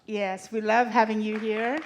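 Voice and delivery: normal talking voice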